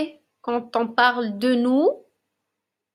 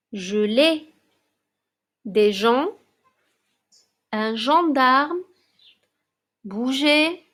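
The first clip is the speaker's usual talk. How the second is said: each word read out alone, evenly paced; slow reading drill; slower than usual. Shown as a demonstration. Teacher reading a word list aloud to young pupils, clear and measured.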